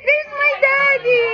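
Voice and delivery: high, loud, delighted cry; excited greeting. Real, not acted. Daughter spotting her daddy at the party, excited greeting. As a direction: announce his arrival in a high, thrilled call.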